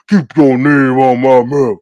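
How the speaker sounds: a hint of a gruff Oscar the Grouch growl; gruff mock voice. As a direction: lightly put on a grouchy, slow voice. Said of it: "that Oscar the Grouch voice".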